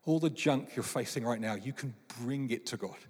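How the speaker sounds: soft reassurance. Real, not acted. Gentle invitation to listeners.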